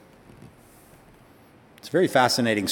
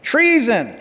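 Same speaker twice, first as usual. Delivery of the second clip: crying out treason; 10 semitones higher, slower than usual. Acted. Athaliah tearing her clothes, crying out to the crowd, high alarmed cry. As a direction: cry it out like a queen caught off guard. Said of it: "and she cried out".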